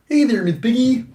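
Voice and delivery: high, loud frog-puppet voice; Kermit the Frog. Retold or imitated. Mocking Jordan Peterson as Kermit, high loud puppet voice. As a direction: call it out as a comic puppet frog. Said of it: "he sounds like Kermit the Frog".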